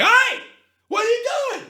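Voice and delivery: high, loud shout across the street; hammed-up high street shout; New Yorker yell. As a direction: holler it like an angry New Yorker. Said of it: "You wouldn't be like".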